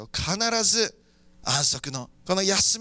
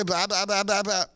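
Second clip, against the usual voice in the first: mocking loud talkers; much faster than usual. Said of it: "大きな声で言ってる奴ら".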